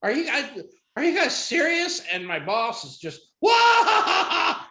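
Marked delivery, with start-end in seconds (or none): 0.0-2.0 high, incredulous woman caller; mock indignation, pitched way up; indignant disbelief. Caller indignant after disconnected call. 3.4-4.6 loud, high mock guffaw; explosive hammed-up belly laugh; uproarious laugh. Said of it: "He's laughing his ass off".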